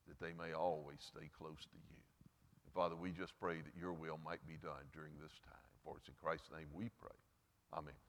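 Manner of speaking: soft, low closing prayer voice. Acted. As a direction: pray quietly and humbly over the congregation. Pastor leads closing prayer.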